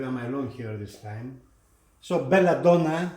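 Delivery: ordinary talk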